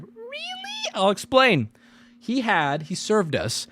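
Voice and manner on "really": very high, breathy squeak of really; high squeaky quoting of Alex; mock falsetto really. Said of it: "That was Alex's tick".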